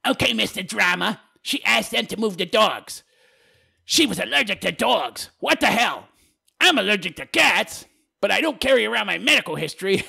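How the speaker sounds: hammed-up huffy objection; mocking commenter voice. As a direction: read it as a mock indignant complainer. Reads viewer's comment in mocking voice.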